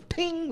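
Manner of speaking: soft, high imitated metallic ping; spittoon ping. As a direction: mimic spit hitting a spittoon.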